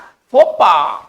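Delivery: फोपा said very slowly and firmly; French pronunciation. Shown as a demonstration. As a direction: model फोपा slowly for students. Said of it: "इसको पढ़ेंगे क्या?". (फोपा बहुत धीरे और ज़ोर से)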